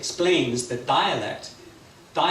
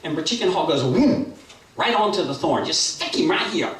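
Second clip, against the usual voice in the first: lively, higher-pitched action narration; animated storytelling; 5 semitones higher, 4 dB louder than usual. A narrator's reading. Storyteller acts out the hawk diving at the sparrow, lively narration. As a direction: build the dive with excited sweep.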